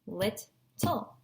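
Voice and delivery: LIT-TLE modelled as lit, pause, tull; LIT-TLE with the break between the t's; split into syllables. Shown as a demonstration. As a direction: say LIT-TLE in two separate chunks. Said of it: "So try and say that".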